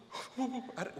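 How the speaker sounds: a quick high gasp; sharp gasp. Retold or imitated. A choked gasp from crying during the blessing. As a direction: catch your breath suddenly. Overcome with emotion in prayer.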